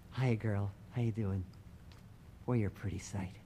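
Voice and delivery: put-on soft, fond hello to an old machine; tender greeting. Man greets his old tank.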